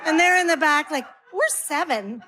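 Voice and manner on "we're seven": lightly voiced little kid; a hint of a plaintive kid; small child. Small kids answering from back.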